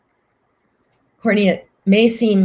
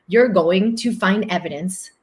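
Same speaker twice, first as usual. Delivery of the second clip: ordinary talk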